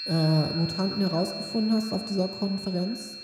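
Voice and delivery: chairman's rallying address to comrades; formal speech. Acted.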